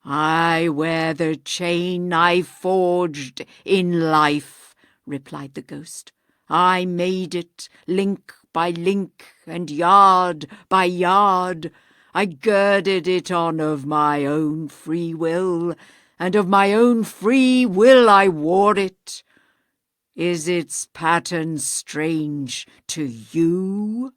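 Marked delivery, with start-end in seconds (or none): 0.1-4.8 hollow ghost. Acted. Voices ghost. 6.5-24.1 hollow ghost. Acted. Voices ghost.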